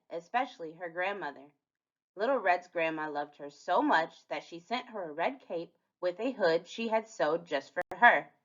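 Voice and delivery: usual speaking voice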